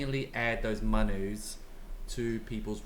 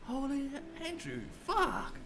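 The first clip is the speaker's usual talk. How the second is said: amazed exclamation; 5 dB quieter than usual. Real, not acted. Amazed reaction to donation.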